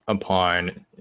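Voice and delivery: normal talking voice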